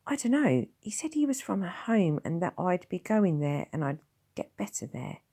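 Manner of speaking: put-on high, timid child's voice; small boy. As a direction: ask like a weak little boy. Will's voice.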